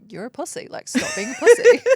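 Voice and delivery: retold taunt at husband, loud and scornful; mock harsh taunt. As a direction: sneer it like the old tough self. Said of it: "I was like".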